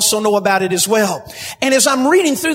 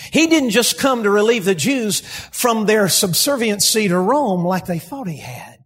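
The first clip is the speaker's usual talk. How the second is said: ordinary talk, nothing marked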